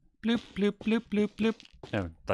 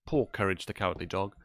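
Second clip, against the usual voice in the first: usual speaking voice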